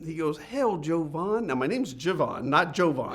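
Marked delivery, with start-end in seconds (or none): 0.4-1.5 slow, drawn-out Texan greeting, pitched up; Texan drawl impression. Texan owner calls the young employee in, drawled. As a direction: stretch "Hail, Jovan" like a country Texan boss. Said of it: "And real country guy, Texan".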